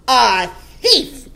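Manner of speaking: loud, outraged cry; screamed accusation. A narrator's reading. Headmistress accuses the boy before all, screamed. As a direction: fling the accusation out at full force. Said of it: "the Trunchbull screamed".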